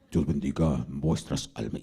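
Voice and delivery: very low, grave churchy tone; ministerial voice. Retold or imitated. Preacher parodying ministers' pompous voice, very low and solemn. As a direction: intone it as if dripping with anointing. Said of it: "el tono de voz ministerial".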